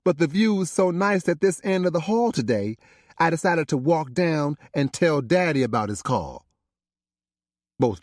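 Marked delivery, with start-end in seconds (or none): 0.0-6.5 much higher, playful daughter's voice; a cheeky excuse with a wink in it. Acted. Paris teases her parents about the view down the hall.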